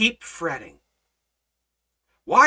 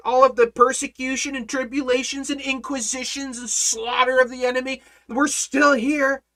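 louder, driving sermon delivery; full-force preaching; 7 semitones higher, 5 dB louder than usual. Acted. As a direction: preach it to the back row.

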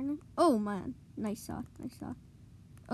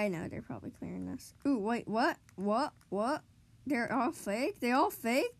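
normal talking voice

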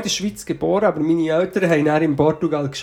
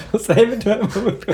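slow, higher mock presenter; mock showman; 6 semitones higher, slower than usual. Joking about the corn dog, mock presenter.

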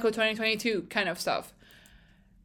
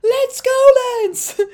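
high, loud, drawn-out fan scream; excited shout; 10 dB louder, slower than usual. Retold or imitated. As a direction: yell it like cheering on a last-second overtake. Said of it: "And I really shouted".

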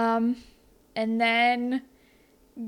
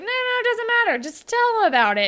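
much higher and louder, insistent; pushy, eager Dave; 13 semitones higher, 6 dB louder than usual. Retold or imitated. Dave pressing Connor to tell the Pop Rocks story, eager. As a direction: urge him on with hammed-up eagerness.

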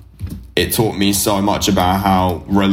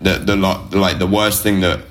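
normal talking voice

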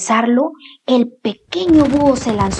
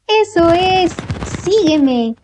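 mother owl voice; 7 semitones higher, slower than usual. Acted.

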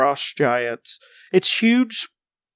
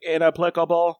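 flat robotic voice. Acted. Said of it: "it just sound like a robot".

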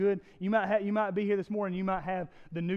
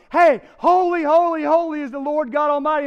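much louder, higher eager shout of holy; an angel calling across heaven with joy; angelic shout; 9 semitones higher, 14 dB louder than usual. Acted.

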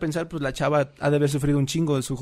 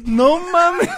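high, loud burst of laughter; laughing; 14 semitones higher, 6 dB louder than usual. Real, not acted. Friend cracks up retelling the bus story, high and loud. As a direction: crack up at your own impression.

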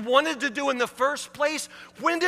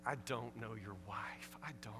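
whispered aside; 13 semitones lower, 19 dB quieter than usual. Real, not acted. Preacher admits to the congregant in a whisper. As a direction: drop to a confiding whisper.

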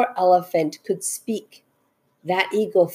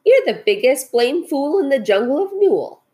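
high, emphatic taunt; jeering; 7 semitones higher, 3 dB louder than usual. A narrator's reading. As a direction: jeer at the fool with gusto. Kangaroos jeering at Horton.

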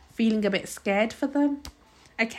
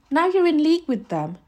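loud, high accusation; indignant, forceful outburst; accusing; 7 semitones higher, 5 dB louder than usual. A narrator's reading. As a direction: round on the culprit, raised voice. Mrs Driver accuses the boy.

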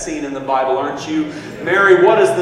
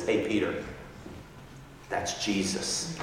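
low, very soft confiding voice; hushed aside; 7 semitones lower, 15 dB quieter than usual. Retold or imitated. John tapping his friend on the shoulder, hushed. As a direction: slip him the news under your breath. John quietly tips off Peter.

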